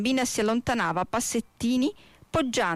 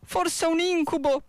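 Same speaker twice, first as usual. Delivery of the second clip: dismayed exclamation; 8 semitones higher than usual. A narrator's reading. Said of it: "pensò".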